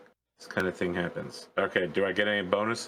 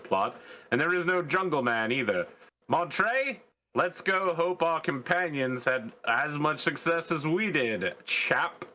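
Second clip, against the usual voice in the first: high, hammed-up London accent; fake Southeast London accent; 4 semitones higher than usual. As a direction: patronise the captive like a chummy Londoner. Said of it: "Southeast London sort of accent".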